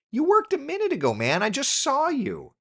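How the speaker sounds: exasperated whine at the machine; frustrated at computer. Real, not acted. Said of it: "I'm frustrated".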